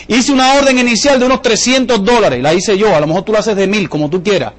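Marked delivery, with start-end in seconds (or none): none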